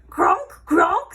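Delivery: high, loud squealing oinks; pig squeal grunts. A narrator's reading. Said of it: "squealed Don Cerdo".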